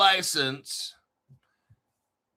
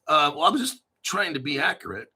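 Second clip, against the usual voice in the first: normal talking voice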